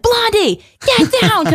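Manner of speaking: high-pitched yelled command, imitating herself on stage; imitated stage scream. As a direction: holler it high at someone in the crowd. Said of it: "like, screaming at you".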